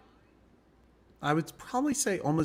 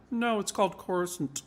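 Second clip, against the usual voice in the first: higher-pitched voice, correcting; imitated film-maker's correction, pitched up; George Lucas; 7 semitones higher than usual. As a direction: say Coruscant as CORE-uh-sunt, correcting. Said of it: "George Lucas called us".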